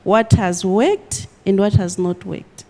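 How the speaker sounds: ordinary talk, nothing marked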